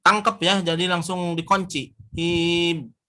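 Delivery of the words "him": him cut off tight on the mim; locked mim. Shown as a demonstration. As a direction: clip him shut. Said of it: "Kunci langsung".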